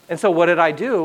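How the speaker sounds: normal talking voice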